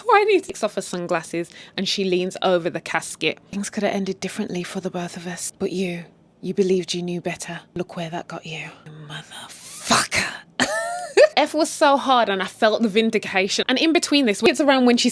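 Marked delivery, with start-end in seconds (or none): 3.5-10.3 menacing whisper. Retold or imitated. Acting out Joyce at the casket. 10.6-11.3 evil laugh. Retold or imitated. Laugh after the casket line.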